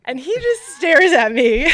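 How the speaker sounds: real laugh breaking through, high and loud; laughing while talking. Real, not acted. Telling how the interviewer stared, laughing helplessly. Laughing at her own awkward story.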